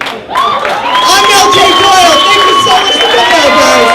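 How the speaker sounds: loud, high stage shout; comedian sign-off. Real, not acted. Comic thanking the crowd, loud and high. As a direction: close the set to the crowd.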